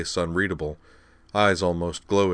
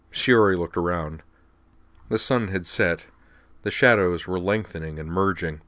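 ordinary delivery

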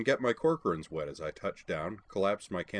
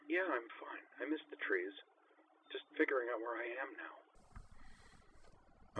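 put-on higher, soft hushed girl's voice; higher and hushed, like over a radio; hushed young woman; 5 semitones higher, 8 dB quieter, slower than usual. A narrator's reading. Sakura whispering over radio.

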